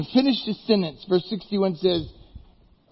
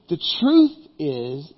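solemn Jesus; 3 semitones lower, slower than usual. Acted. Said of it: "kinda sounds like".

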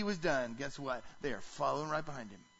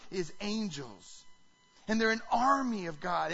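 ordinary talk, nothing marked

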